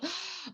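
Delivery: quick high gasp; sharp mock gasp. Cutting off her own hostel rant with a sharp gasp. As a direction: catch the breath sharply, half in jest. Retold exasperation, cut off.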